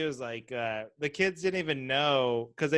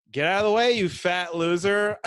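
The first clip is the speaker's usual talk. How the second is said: louder, higher insult, slowing at the end; rude cyclist; 4 semitones higher, 7 dB louder than usual. Retold or imitated. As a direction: snap at someone blocking the path. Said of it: "without it sounding like".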